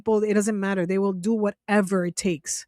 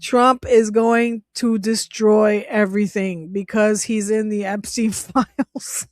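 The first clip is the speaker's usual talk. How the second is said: fighting laughter, breaking into giggles; real laughing. Real, not acted. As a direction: try to get it out through helpless laughter. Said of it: "I'm sorry, I'm laughing".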